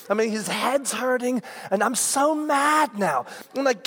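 usual speaking voice

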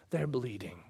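hushed whispered aside; tender whisper. Acted. Jesus comforting the sinner, soft whisper. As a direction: whisper it gently, almost to yourself.

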